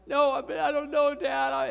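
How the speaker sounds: high, loud mock wailing; imitated child crying. Sammy can't be calmed by Dad, mock crying. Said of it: "Sammy just kept crying and crying and crying".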